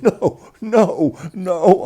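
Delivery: high, loud repeated no, imitating sister; emphatic refusal. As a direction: refuse in acted alarm. Sister refusing to watch the shave.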